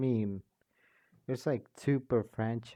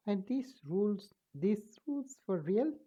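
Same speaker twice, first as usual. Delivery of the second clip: a much higher, unsure voice asking; high character voice; 8 semitones higher than usual. Acted. A character unsure whether the rules are real, pitched high. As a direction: pitch it way up and sound doubtful.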